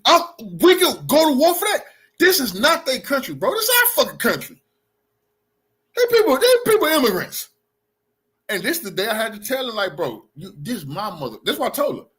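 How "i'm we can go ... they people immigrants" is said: heated shout. Real, not acted. Arguing with the heckler.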